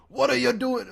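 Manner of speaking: high, loud, hammed-up Shrek voice; Shrek impression. Shown as a demonstration. Showing his co-host how Shrek should sound, loud and high. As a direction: belt it out like a cartoon ogre. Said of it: "you gotta do an impression".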